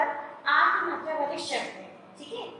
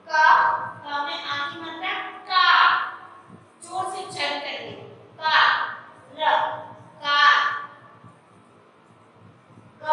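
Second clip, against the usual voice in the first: teacher leading loud phonics recitation; loud syllable drill; 6 dB louder than usual. Shown as a demonstration. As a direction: call out each letter loudly for the class to repeat. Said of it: "मेरे साथ loudly बोलेंगे".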